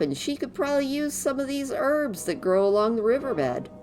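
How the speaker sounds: cheerful little helper, quick; christmas elf. Acted. Voices Gidwin's dialogue.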